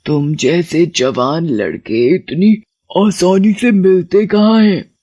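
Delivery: slow, gloating witch voice. Acted. Witch gloating to the young man, slow. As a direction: relish the catch.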